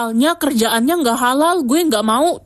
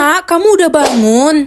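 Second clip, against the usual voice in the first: louder, cheerful greeting; happy mother; 3 semitones higher, 6 dB louder than usual. Acted. Mother to her child on waking, happily. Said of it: "tumben hari ini Mama seneng".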